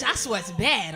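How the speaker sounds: normal talking voice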